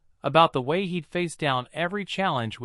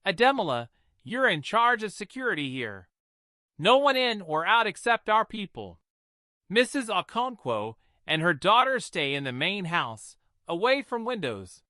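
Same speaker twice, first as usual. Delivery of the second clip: raised, higher voice of a firm police commander; commanding deputy commissioner; 6 semitones higher than usual. A narrator's reading. Deputy commissioner ordering the general, firm commanding voice. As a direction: take charge and set the plan. Obi gives guard orders.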